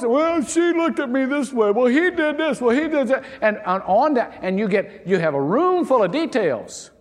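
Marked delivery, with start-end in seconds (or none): 0.0-3.2 put-on high, loud tattling child; whiny kid. Kids explaining the fight to a parent, high and whiny. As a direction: blame the sibling like a bickering kid. Mimicking squabbling kids' excuses.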